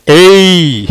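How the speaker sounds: "ஏய்" drawn out as one long sound; long vowel demo. Shown as a demonstration. Teacher joins a and i for learners, "ஏய்" held long. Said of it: "அப்படின்னு சொல்லுவோம்".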